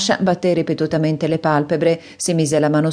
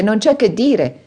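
raised, eager praise; enthusiastic exclaim; 6 semitones higher, 3 dB louder, slower than usual. A narrator's reading. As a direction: gush over the candlestick. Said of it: "esclamò egli ridendo".

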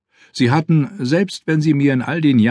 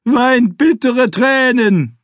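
booming, slow, much higher preacher cry; thundering; 14 semitones higher, 4 dB louder, much slower than usual. Acted. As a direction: thunder it from the pulpit, slowly. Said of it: "donnerte der Mönch".

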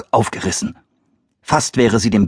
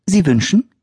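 polite mother; 11 semitones higher than usual. Acted.

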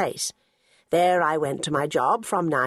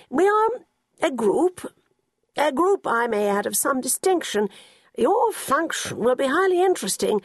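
put-on grand old gentleman; 3 semitones higher than usual. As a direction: puff up the group's importance. Narrator voices Sir Quentin.

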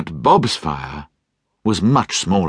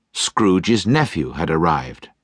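ordinary delivery

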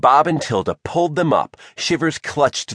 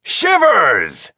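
long, raised, hearty call of the name; gruff friendly bellow; 8 semitones higher, 4 dB louder than usual. A narrator's reading. Bob greets Shivers from the ship, bellowing gruffly. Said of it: "bellowed a gruff but friendly voice".

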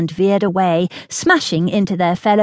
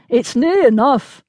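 higher-pitched Highland Scots grunt; Highland Scots accent; 5 semitones higher than usual. A narrator's reading. Sergeant setting down the heavy cannon, Highland Scots grunt. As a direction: Highland Scots sergeant straining under a load. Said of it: "grunted in his Highland Scots accent".

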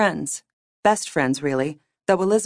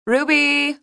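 high calling-out yell; sustained high-pitched holler; 10 semitones higher, 3 dB louder than usual. A narrator's reading. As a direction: call up to someone upstairs. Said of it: "Zoe yelled, craning her chin skyward".

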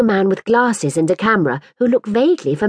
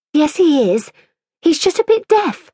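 put-on a higher, superior little girl; lightly voiced haughty girl; 9 semitones higher than usual. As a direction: look down your nose at the toy. Mirabel's haughty voice.

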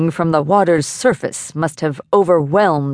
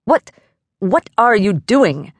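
drawn-out, higher-pitched demand; indignant demand; 5 semitones higher than usual. A narrator's reading. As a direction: demand an explanation, stretching the words. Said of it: "the dowser demanded".

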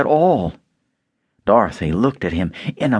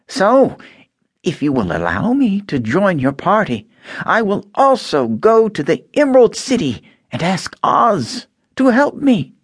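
wistful Tin Man; 10 semitones higher than usual. A narrator's reading. Tin Man asking Dorothy about Oz, hopeful. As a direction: ask hesitantly, longing for a heart.

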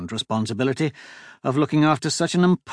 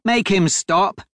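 raised-pitch, insistent order; sharp command; 7 semitones higher, 4 dB louder, slower than usual. A narrator's reading. Duchess to Devon about the driver, sharp high command. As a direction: demand it, pitched up. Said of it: "shot a sharp look his way and commanded".

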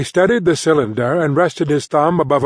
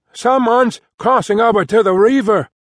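lookout's shout, pitched up; 8 semitones higher than usual. Acted. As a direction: call the warning down from the masthead. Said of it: "Elpidio shouted".

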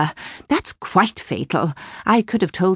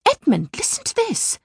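much higher, urgent summons; urgent call; 13 semitones higher than usual. Acted. Mother calling Edmund over to the notice, urgent. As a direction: grab his attention now.

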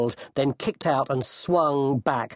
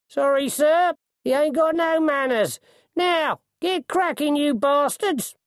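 very high, raised coachman voice; coachman apologizing, then ordering; 3 dB louder than usual. A narrator's reading. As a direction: excuse the men to a superior, then send them off.

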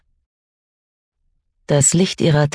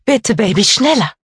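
desperate pleading; 8 semitones higher than usual. Acted. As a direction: beg him in panic to run faster. Mother begging child to hurry.

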